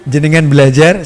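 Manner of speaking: ordinary talk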